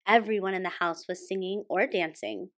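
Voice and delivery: usual speaking voice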